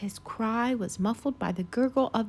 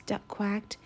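plain reading voice

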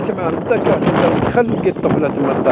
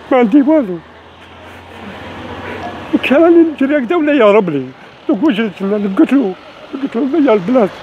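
angry crying; 4 semitones higher than usual. Real, not acted. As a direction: cry out the injustice, near tears. Man cheated, crying out in anger.

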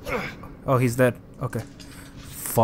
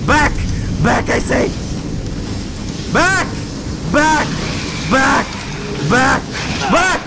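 yelling back; 9 semitones higher, slower than usual. Real, not acted. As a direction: shout it off. Fighting off a creature.

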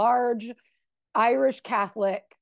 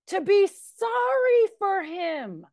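ordinary delivery

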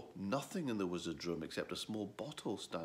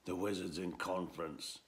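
growled. Acted. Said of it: "it growled".

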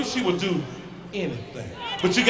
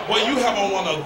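ordinary talk

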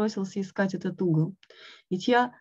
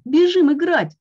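high, loud, eager call; 8 semitones higher, 9 dB louder than usual. A narrator's reading. Frog begging Toad to come play, high and eager. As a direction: urge him out to play, bursting with glee.

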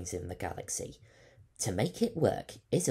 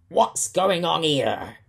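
loud, slow, higher pirate roar; 8 semitones higher, 13 dB louder, slower than usual. Acted. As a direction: bellow the curse at the room. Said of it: "he roared".

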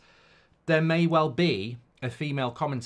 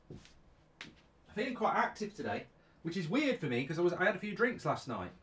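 talking mid-stretch; 5 semitones higher, 5 dB quieter than usual. Real, not acted. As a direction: speak while stretching your body out. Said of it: "I'm gonna have a stretch".

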